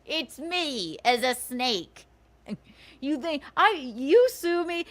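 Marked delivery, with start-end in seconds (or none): 3.0-4.9 incredulous. Real, not acted. Mocking their threat incredulously.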